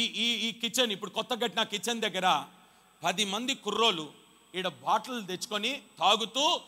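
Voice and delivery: ordinary delivery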